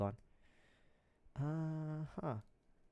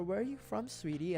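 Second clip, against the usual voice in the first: lightly voiced old lady, soft; 5 semitones higher, 6 dB quieter than usual. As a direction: imitate a kindly old lady's soft question. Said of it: "when it's an old lady asking me".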